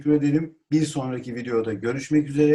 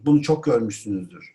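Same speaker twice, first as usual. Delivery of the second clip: ordinary delivery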